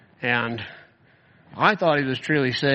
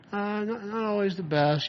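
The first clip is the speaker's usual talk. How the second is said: higher, softer noncommittal mumbled excuse; vague hedging; 7 semitones higher, 4 dB quieter than usual. Retold or imitated. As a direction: imitate someone hedging around a confession. Imitating player's vague, evasive answer.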